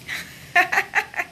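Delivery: short run of high laughter; amused laugh. Real, not acted. Host tickled by her own yes-no-maybe answer, high laughing. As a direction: laugh at your own joke.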